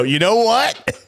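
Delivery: lightly voiced teasing imitation; imitating cohost. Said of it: "when you go".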